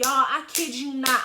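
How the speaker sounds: ordinary delivery, nothing marked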